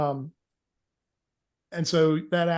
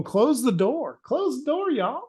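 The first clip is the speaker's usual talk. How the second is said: higher, urgent pilot calling back; pilot urging; 7 semitones higher, slower than usual. Acted. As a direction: press them to shut the door. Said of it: "you can hear the pilot going".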